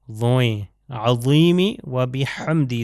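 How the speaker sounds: عظیمی with a throaty ع, drawn out slowly; slow Arabic recitation. Shown as a demonstration. Teacher recites the ruku dhikr slowly. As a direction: intone عظیمی و بحمدی slowly. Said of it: "اگلے والے میں بھی دیکھیے".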